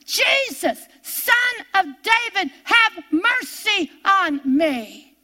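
high, drawn-out imitated plea; crying out like Bartimaeus. Calling to Jesus amid all the housework, imitated drawn-out cry. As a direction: call out high, then stretch the plea slowly. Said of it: "I turned into blind Bartimaeus".

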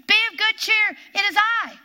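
Jesus voiced loud and high, reassuring; calling out. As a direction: call across the water to calm them.

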